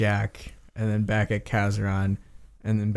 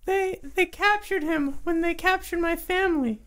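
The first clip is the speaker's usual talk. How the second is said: high, squeaky creature, halting; anxious kobold. Acted. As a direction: tell the sad tale as a tiny kobold. Game master voices kobold.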